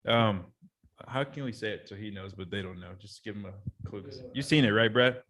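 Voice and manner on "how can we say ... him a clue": softer, dropping into a secret aside; spoiler-hiding hush. Real, not acted. Podcaster hushing to hint a spoiler to a cohost, soft and sly.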